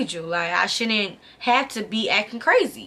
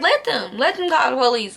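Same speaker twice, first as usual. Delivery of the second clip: retold defiance; 4 semitones higher than usual. Daring the boyfriend blocking the hotel door, higher defiant retort. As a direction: throw it back at him, daring.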